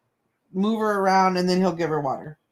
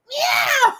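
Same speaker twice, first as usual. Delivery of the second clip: a cheeky meow, much higher, through a laugh; sassy mock meow; 4 dB louder than usual. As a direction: meow back with attitude, laughing. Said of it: "her meow changes".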